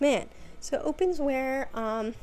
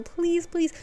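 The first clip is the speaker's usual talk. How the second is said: higher-pitched begging; uncomfortable pleading; 7 semitones higher, 3 dB louder than usual. Retold or imitated. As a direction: plead for it to stop, half joking. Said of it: "I'm so uncomfortable".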